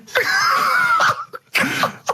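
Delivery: squealing laugh, breathless; high burst of laughter. Real, not acted.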